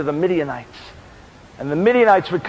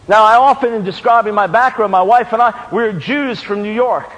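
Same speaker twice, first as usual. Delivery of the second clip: ordinary talk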